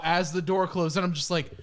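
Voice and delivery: usual speaking voice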